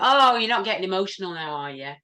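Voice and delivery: loud, mocking put-down; mocking dismissal. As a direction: sneer it like a dismissive colleague.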